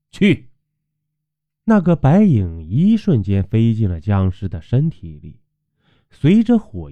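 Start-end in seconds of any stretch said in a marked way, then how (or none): 0.1-0.5 high barked command; sharp command. A narrator's reading. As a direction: snap the word out. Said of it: "喝道".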